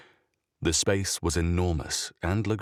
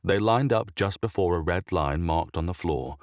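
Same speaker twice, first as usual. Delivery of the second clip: plain reading voice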